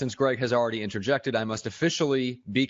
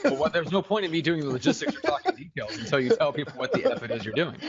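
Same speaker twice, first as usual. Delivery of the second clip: higher-pitched, talking through laughter; laughing; 6 semitones higher than usual. Real, not acted. Host teases confused co-host about going first, laughing. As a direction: laugh your way through the explanation.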